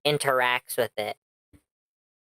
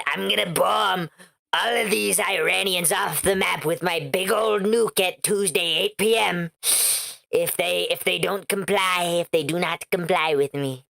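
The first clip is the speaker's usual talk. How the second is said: higher-pitched mock politician's threat; Trump; 4 semitones higher than usual. Streamer parodying a president threatening to bomb, mocking impression. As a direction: put on a blustering caricature of a president. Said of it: "after Trump said".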